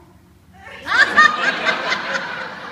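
high loud burst of real laughter; storyteller laughing out loud. Real, not acted. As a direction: crack up mid-line. Teller bursts out laughing mid-quote.

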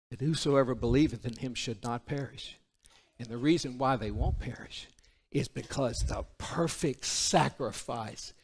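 low, soft pulpit voice with pauses; hushed reverent preaching. Real, not acted. Preacher telling congregation of God's sacrifice, hushed. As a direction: bring it right down in reverence.

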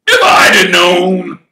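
loud, slow furious shout; Hagrid's outrage. Acted. Hagrid furious about the Dursleys, shouting loud. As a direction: roar with protective anger.

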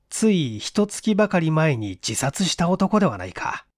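ordinary delivery, nothing marked

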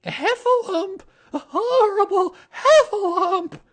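high, loud, terrified squeal; Piglet crying out. A narrator's reading. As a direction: shriek the alarm as a tiny animal. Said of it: "cried Piglet".

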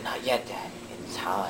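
hushed, mysterious near-whisper; secretive. Acted. Son putting off his dad's question, hushed and secretive. Son's secretive refusal, voice dropped.

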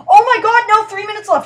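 high, loud burst of alarm; alarmed outburst. Real, not acted. Gamer spots the clock running out, alarmed outburst. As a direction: blurt it out in sudden panic. Sudden panic over game clock.

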